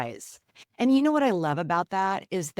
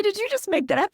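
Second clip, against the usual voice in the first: much higher and louder, caught off guard; amazed question; 5 dB louder, faster than usual. Retold or imitated. Wife amazed at her husband's clever saying, high surprised question. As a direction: squeal it out in delighted disbelief.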